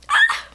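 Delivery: very high, short "ah" squeal; over-the-top little shriek; sudden squeal. Real, not acted. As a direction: let out a high excited yelp. Sudden silly squeal, then apologizes.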